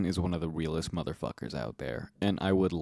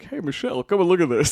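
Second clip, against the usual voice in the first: higher, louder Obama voice; Obama impression; 11 semitones higher, 6 dB louder, faster than usual. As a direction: call out to Michelle as Obama. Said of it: "Obama impression".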